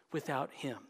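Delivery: ordinary talk, nothing marked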